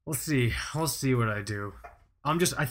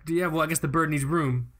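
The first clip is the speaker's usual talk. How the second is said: ordinary delivery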